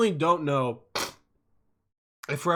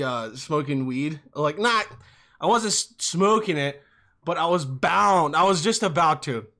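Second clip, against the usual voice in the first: ordinary delivery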